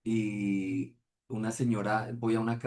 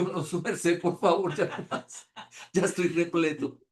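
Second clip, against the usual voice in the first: a touch higher, pleading with a laugh; laughing plea; 7 semitones higher, slower than usual. Retold or imitated. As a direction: beg off, half laughing. (ruego entre risas, algo agudo)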